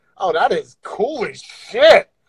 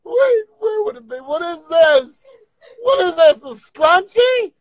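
panicked crying out; 12 semitones higher than usual. Real, not acted. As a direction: wail in disbelief. Crying out at filters.

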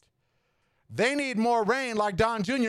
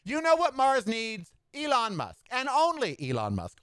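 normal talking voice

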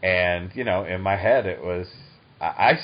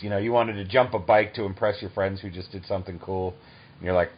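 usual speaking voice

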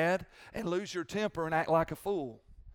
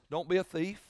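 ordinary talk, nothing marked

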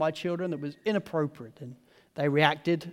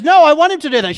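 loud, raised-pitch insistence; heated arguing; 9 semitones higher, 15 dB louder, faster than usual. Retold or imitated. Dad quarrelling with mum over kid, heated arguing. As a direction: argue back forcefully as dad.

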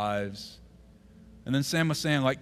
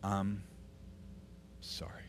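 hushed apology; 4 semitones lower, 12 dB quieter than usual. Retold or imitated. Retold whispered apology.

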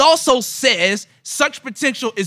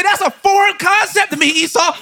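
imitating Paul; 10 semitones higher than usual. Paul voiced, high.

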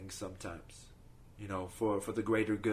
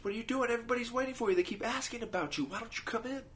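put-on much higher small child's voice; child Jesus; 11 semitones higher, 3 dB quieter, faster than usual. Child Jesus calls the man from Crete inside, childlike and high. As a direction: speak as a little child calling him in. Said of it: "child Jesus comes out".

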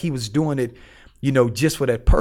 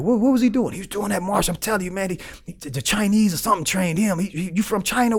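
higher-pitched mimicry of excited inmates; imitated excitement, pitched up; excited gossip; 7 semitones higher than usual. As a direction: lightly act out a buzzing rumour.

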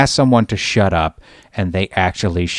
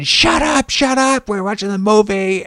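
very high, hammed-up shushing shout; mock crowd yell; 11 semitones higher than usual. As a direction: yell it like the annoyed audience.

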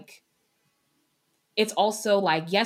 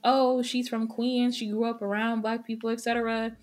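mocking excuse; 6 semitones higher than usual. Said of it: "I hate when people use that excuse of".

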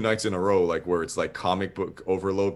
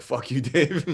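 retold curse breaking into laughter; laughing mock curse; 4 semitones higher than usual. Artist grumbling at Dave over the drawing, retold with a laugh. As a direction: curse him playfully, laughing through it. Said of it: "cursing the whole time".

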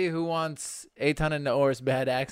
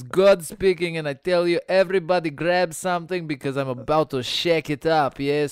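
hype call; 4 semitones higher, 5 dB louder than usual. Pretend. Hyping everyone to dance.

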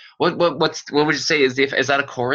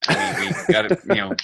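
laughing; 12 semitones higher than usual. Real, not acted. Joking about capturing their guest.

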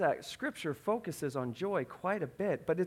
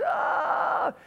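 high, loud mock shriek; imitated top-of-the-lungs screech; imitated child's scream; 9 semitones higher, 6 dB louder than usual. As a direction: scream like a terrified toddler. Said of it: "screaming at the top of her lungs".

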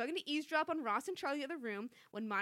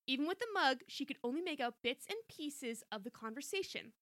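ordinary talk, nothing marked

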